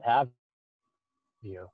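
ordinary delivery

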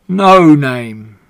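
a lightly voiced big, hearty pig. Uncle the big pig introducing himself to Charlotte, hearty. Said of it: "he said in a big, hearty voice".

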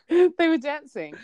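loud, high laughing echo. Real, not acted. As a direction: laugh through the words. Laughs echoing friend's remark.

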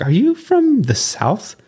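high, slow put-on voice for the friend's question; imitating his friend. Voice-actor friend asks if he's from the South, high slow mock voice. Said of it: "but he's like".

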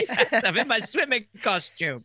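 put-on lightly laughing English accent; mock posh English. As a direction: announce it grandly, laughing. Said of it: "mocking the phrase".

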